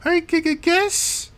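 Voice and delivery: high falsetto, halting; awkward daughter hostess. Acted. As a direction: announce dinner hesitantly in a high voice. Game master answers as the daughter.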